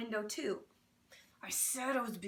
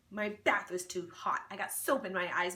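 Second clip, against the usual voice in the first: ordinary delivery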